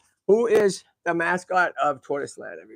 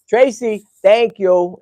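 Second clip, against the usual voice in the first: normal talking voice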